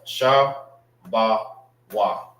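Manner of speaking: "Sha, ba, wa" spoken as three slow separate syllables; Hebrew syllables. Shown as a demonstration. Teacher sounding out "Sha, ba, wa" syllable by syllable for the class. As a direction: sound out "Sha, ba, wa" one by one, with a pause between each. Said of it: "That's how you say a gate in the Hebrew".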